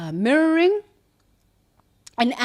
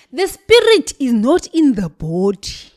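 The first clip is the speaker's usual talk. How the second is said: strong Zulu-accented delivery, louder and higher; Zulu woman accent; 4 semitones higher, 8 dB louder than usual. Shown as a demonstration. To her viewers, demonstrating the line in a Zulu accent. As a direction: say it like a Zulu woman would, with weight. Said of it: "I need to speak like a Zulu woman".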